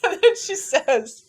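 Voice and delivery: real laughter breaking through the words; high, loud, laughing through it. Real, not acted. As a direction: try to go on while cracking up. Laughing too hard to go on.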